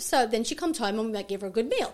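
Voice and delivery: ordinary delivery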